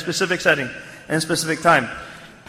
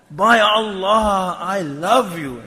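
slow, stretched "By Allah, I love you"; emphatic declaration; much slower than usual. Retold or imitated. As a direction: weigh every word of "I love you". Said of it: "It's not like, you know, "I love you".

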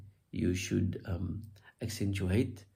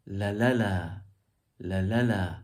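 "la la la" with the second la stressed; "la la la", peak in the middle, then falling; stress on middle syllable; 4 dB louder than usual. Shown as a demonstration. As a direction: lean on the middle la in "la la la". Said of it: "more eloquent would be".

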